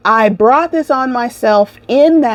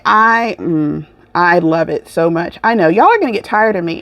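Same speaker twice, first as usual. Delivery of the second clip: usual speaking voice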